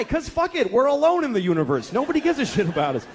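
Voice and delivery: high-pitched nihilistic outburst; despairing rant. Acted. Father answering endless whys, exasperated despair. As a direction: throw it out, at the end of your rope.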